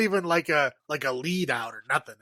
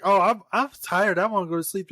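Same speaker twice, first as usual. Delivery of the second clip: put-on higher, whiny sleepy-kid voice; 4 semitones higher, 3 dB louder, faster than usual. Son tells his parents he is tired, mock whine. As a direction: play the drowsy son, half-hearted. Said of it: "And he's like".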